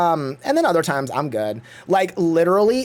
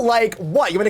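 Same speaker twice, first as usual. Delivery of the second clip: ordinary talk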